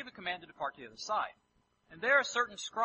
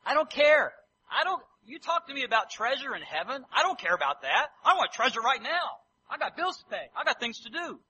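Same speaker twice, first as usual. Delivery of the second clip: loud, higher, scoffing at treasure in heaven; defiant dismissal; 4 semitones higher, 8 dB louder than usual. Acted. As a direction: brush it off like a busy businessman. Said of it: "We could try to put a little life in it".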